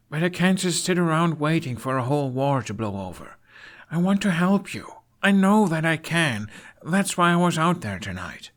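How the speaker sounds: high, pleading apology; earnest Hiccup. Acted. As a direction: beg your father to let you help.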